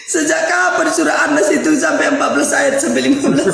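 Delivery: can't stop laughing, stomach aching; laughing through pain. Real, not acted. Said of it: "kebanyakan ketawa gua jadi sakit perut".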